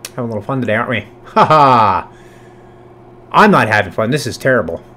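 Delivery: ordinary talk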